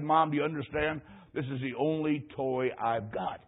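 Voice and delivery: put-on understated childlike appeal; pleading boy. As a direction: quietly beg to keep the only toy. Boy pleads to keep toy.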